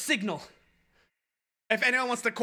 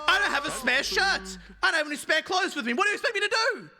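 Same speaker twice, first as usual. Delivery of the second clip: high-pitched, worked-up mock yell; retold yelling; 8 semitones higher than usual. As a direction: imitate an irate passenger's rant, pitch climbing. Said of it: "he just starts yelling at me".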